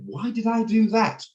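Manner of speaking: high-pitched, incredulous question to himself; baffled self-questioning. Retold or imitated. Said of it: "look back in my life and think".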